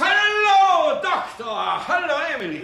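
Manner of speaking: high, loud cheerful call across the airfield; calling out hello. Acted. Al calls out to the doctor and Emily at the airport, high and loud.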